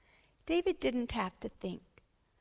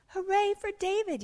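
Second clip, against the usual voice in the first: high, raised cheer; yelled cheer; 12 semitones higher, 6 dB louder than usual. Acted. Class hails David for catching the gerbils, yelled cheer. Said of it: "yelled the class".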